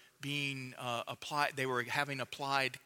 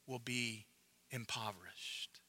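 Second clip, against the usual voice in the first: whispered; 4 semitones lower, 9 dB quieter than usual. Real, not acted. Preacher trailing into a whisper on a grave word. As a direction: whisper impoverished, voice dropped away. Hushed sermon climax.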